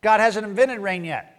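mocking crowd. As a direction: tease the boat builder. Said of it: "everybody laughed, go".

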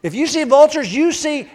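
Jesus pointing out the vultures, loud and high; emphatic Jesus. Retold or imitated. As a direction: grab their attention. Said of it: "Jesus goes".

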